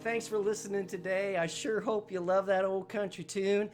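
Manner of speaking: ordinary talk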